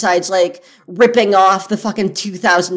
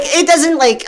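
ordinary talk

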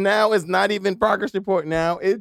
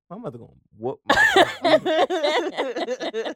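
half-laughing dread, butt jumps up high; laughing panic; 11 semitones higher, 4 dB louder, slower than usual. Retold or imitated. Kid dreading mom's whipping, laughing and panicky. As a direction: blurt it out, cracking up on the last word. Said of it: "I said".

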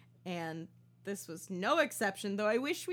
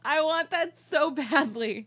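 excited; 6 semitones higher, 8 dB louder than usual. Real, not acted. Wishing the show did this.

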